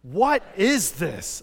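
loud, very slow and drawn out; said loudly. Shown as a demonstration. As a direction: belt out each word for all to join. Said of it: "Say it loudly".

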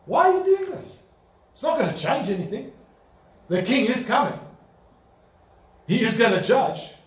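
high, confident rebuke; bold challenge. Shown as a demonstration. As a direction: call them out fearlessly. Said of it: "Otherwise, you can say".

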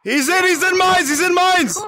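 high, urgent call-out; excited call-out. Real, not acted. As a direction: shout the location out. Calling out in game.

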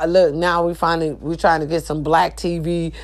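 ordinary delivery

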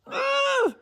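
very high, strained "ugh"; exasperated growly "ugh". Retold or imitated. Mom showing how far her voice went, high strained "ugh". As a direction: squeeze out a high noise of frustration. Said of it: "to this, like, very level of".

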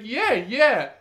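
high, loud mimicked agreement; eager bar girl. Bar girl confirms the deal to customer, eager imitation. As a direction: imitate a keen hostess saying yes. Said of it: "she's like".

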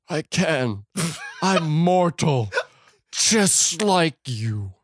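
very slow, high, strained melodrama; hammed-up deathbed speech; mock dying words. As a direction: drag out each word as if expiring. Acts Raiden's dying words.

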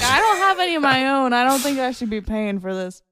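mock-indignant farmer with a slight Southern accent. Playing the farmer objecting to the school tax, slight Southern accent. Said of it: "giving him slightly Southern accent".

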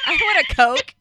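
high, loud laughing demand; a laughing, hammed-up Coke order; mock order.

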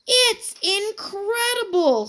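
high, slow amazed words; stunned at the size; awed. A narrator's reading. Boy stares at the huge roots, slow high awe. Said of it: "said Terrence".

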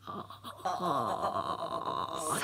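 creaky unvoiced ah ah; vocal fry. Shown as a demonstration. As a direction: rasp through ah ah with fry. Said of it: "エッジボイスやっていただく".

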